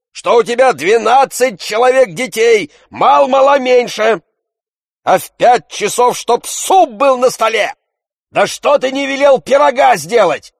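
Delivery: Tarantyev shouting. A narrator's reading.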